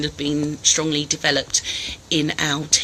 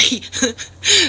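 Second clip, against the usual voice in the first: short laugh; 8 semitones higher than usual. Real, not acted. Child about to interrupt stream.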